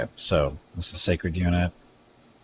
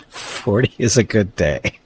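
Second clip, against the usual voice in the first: laughing through the words, slowed; giggling it out slowly; 4 semitones higher, 5 dB louder, slower than usual. Real, not acted. Streamer repeating a chat joke while laughing.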